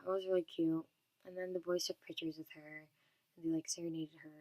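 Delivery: ordinary talk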